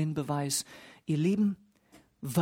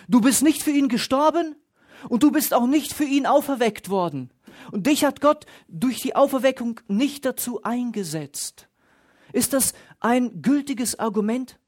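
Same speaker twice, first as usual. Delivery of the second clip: loud, higher, insistent rhetorical challenge; challenging preaching; 6 semitones higher, 5 dB louder than usual. Acted. Pastor rebuking listeners who judge their brother, loud challenging preaching. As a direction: throw it at the listener like an accusation.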